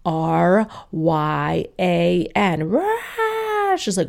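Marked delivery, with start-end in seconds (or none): 0.1-2.7 very slow, letter by letter; laboured spelling. Retold or imitated. Mother spelling her surname for a receptionist, slow and laboured. 2.7-3.8 high, drawn-out mock baby shriek; imitated baby scream. Baby interrupting mother's phone call, mock scream.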